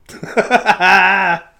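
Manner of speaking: loud high burst of laughter. Real, not acted. Host laughing at his own podcast joke, loud laugh. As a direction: crack up out loud.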